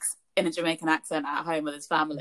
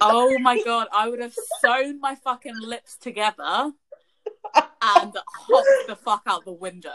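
high, loud, scandalised outburst; real, emphatic second-hand mortification; appalled amusement; 6 semitones higher, 6 dB louder than usual. Real, not acted. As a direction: exclaim in horror, breaking into laughter. Appalled listener reacts, laughing.

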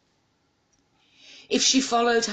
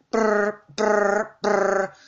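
imitated phone ring; 6 semitones higher, much slower than usual. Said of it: "phone ringing".

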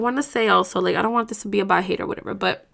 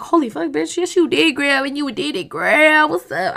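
put-on higher, louder cheering on herself, girl; hyping herself up; 5 semitones higher, 3 dB louder than usual. Cheering herself on for getting through, excited. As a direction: gas yourself up like a best friend. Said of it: "'cause it's like".

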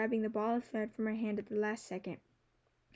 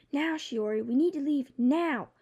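urgent, raised warning cry; 4 semitones higher, 6 dB louder than usual. A narrator's reading. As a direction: shout the warning with alarm. Bird urges her to flee.

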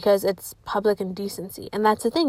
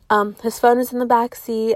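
ordinary delivery

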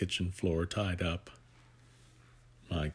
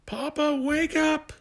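put-on very high, loud child's cry; childish yell; 5 dB louder than usual. Jason yells to wake his father, high loud cry. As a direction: shout it up like a small boy. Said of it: "Jason yelled".

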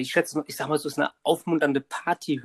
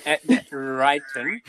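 American accent; 4 dB louder, slower than usual. Shown as a demonstration. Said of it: "so richtig, ja".

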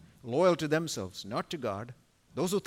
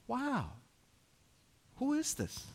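amazed wonder; 10 semitones higher, 4 dB quieter than usual. Real, not acted. Preacher marvels at the king.

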